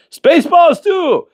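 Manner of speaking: very high, hammed-up character voice; movie parody voice. Mocking the sequel they're making, high cartoonish voice. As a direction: announce the sequel title high and stretched.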